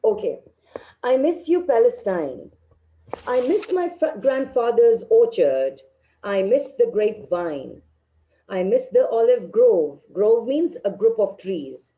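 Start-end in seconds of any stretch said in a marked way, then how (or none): 1.0-10.0 poem reading. Acted. Teacher reads the poem aloud.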